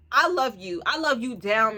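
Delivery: ordinary talk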